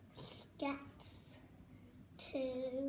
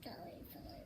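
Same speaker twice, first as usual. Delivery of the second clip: whisper; 6 semitones lower, 10 dB quieter than usual. Real, not acted. Child whispers answer.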